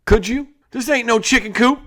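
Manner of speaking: put-on high, loud annoyed kid; irked kid. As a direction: shove back at the crowding. Ellie irked.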